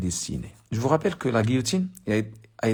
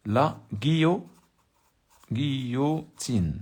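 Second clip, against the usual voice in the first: drawn-out word; 3 semitones higher, much slower than usual. Shown as a demonstration. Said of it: "dramatic reading".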